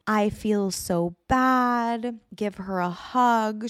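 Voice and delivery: slow, syrupy mock concern, lightly voiced; mock sympathy. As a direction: drag it out like gushing neighbours. Mocking group chat texts.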